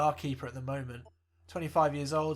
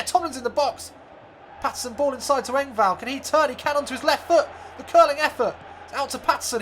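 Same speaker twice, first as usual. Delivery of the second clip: play-by-play building to a much higher, louder yell at the post; live commentary; 4 semitones higher, 8 dB louder than usual. Acted. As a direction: call the chance rising to a scream. Commentator calling the match.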